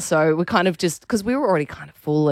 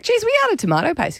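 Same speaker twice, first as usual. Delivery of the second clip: high, surprised "Geez"; mock surprise; 12 semitones higher than usual. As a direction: act surprised at the empty cupboard. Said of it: "I'm like".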